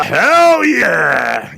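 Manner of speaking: slow, loud drawn-out cheer; hell yeah shout. Real, not acted. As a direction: yell it like a hype man. Excited cheer.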